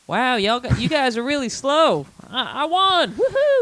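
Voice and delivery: high, goofy mock celebration; gloating troll. Troll who wins the race taunting furious players, goofy gloating. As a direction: rub it in with a high-pitched whoop.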